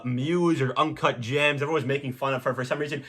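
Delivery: ordinary talk, nothing marked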